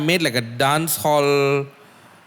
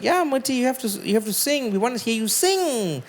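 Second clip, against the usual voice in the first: much higher, mock-pleading female voice; high voice of a woman; 10 semitones higher than usual. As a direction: pitch way up to play Meredith urging him. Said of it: "Meredith was like".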